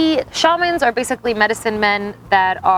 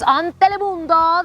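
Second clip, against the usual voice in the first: Spanish accent; 6 semitones higher than usual. Shown as a demonstration. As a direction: say Telemundo with a Spanish accent, a bit higher. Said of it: "You gotta say it like that with the accent".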